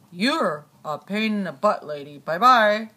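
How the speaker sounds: low rumbling insult; deep grumpy voice. Pretend. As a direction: grumble it like a cranky giant.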